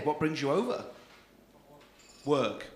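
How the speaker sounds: normal talking voice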